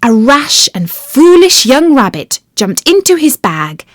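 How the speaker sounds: ordinary delivery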